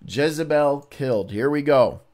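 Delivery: normal talking voice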